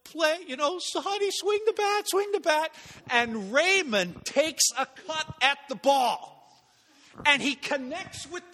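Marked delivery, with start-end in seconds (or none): 0.0-2.7 lightly voiced sweet mom; a mom gently coaxing her son to swing; coaxing mother. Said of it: "his mother's going".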